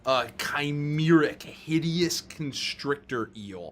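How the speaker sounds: low, measured scene description; scene narration. A narrator's reading. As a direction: paint the monster slowly and ominously. Game master describes the monster.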